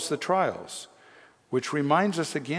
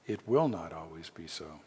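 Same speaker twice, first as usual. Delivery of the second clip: lower, softer reassurance; hushed repeat; 6 semitones lower, 5 dB quieter than usual. Real, not acted. Preacher reassures suffering congregation, low and soft. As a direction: let it settle quietly after the bold claim. Same promise repeated after long pause.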